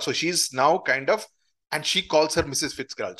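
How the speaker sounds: normal talking voice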